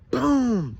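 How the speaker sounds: loud, high "boom" dropping in pitch; punch sound effect. A narrator's reading. Narrator voices her punch landing, loud booming impact. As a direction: punch out a big cartoon impact noise. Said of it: "she punches him".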